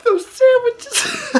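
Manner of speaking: tiny high squeal, stretched out; real tearful laughter swallowing the words; crying with laughter. Real, not acted. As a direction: wheeze it out slowly while helplessly laughing. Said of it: "like, crying".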